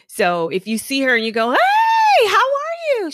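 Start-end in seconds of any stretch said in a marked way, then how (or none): 1.5-3.1 way up high, loud and bright; bubbly greeting. Acted. You greeting the tired client brightly. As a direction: throw her a big cheery hello.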